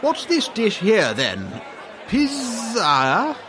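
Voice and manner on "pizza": pizza sounded out with a long buzzing Z; mispronounced. Acted. Diner asks about an unknown dish, mispronouncing pizza. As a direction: read pizza as "pizz-ah", questioning. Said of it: "It's pronounced pizza".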